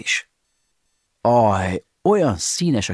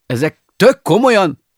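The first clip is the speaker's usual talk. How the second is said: panicked disbelief; 10 semitones higher, 7 dB louder than usual. Acted. Pushed out the window, panics.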